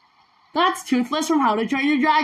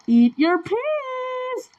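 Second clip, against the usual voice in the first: high, slow mock parent order; sing-song mum telling him to eat; playing mum at the dinner table, slow and high; bossy parent; 12 semitones higher, much slower than usual. Bossing Toothless like a parent.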